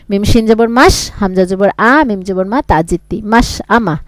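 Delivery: soft, trailing spelling demo; quiet spelling drill. Shown as a demonstration. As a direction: spell মিম শিন জবর মাস softly, letter by letter. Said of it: "এরকম করে পড়তে থাকবেন।".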